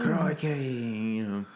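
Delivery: slow hammed-up Australian drawl on Crikey; slow, a jokey Aussie twang; lightly put-on Australian accent; mock Australian accent. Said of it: "the thickest Australian accent".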